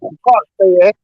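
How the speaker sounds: broken-up call audio; choppy cut off endings. Real, not acted. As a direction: voice cutting in and out. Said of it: "you're cutting out a lot".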